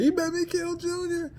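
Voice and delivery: very high wailing falsetto; shrill tearful mock rage; mock crying threat. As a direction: sob out the threat, hammed up. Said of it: "that whole scene of him crying".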